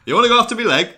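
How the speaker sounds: high, loud Geordie promo; Geordie accent. Acted. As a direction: act the wrestler's challenge in Geordie. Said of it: "That sounds more Yorkshire than fucking Geordie".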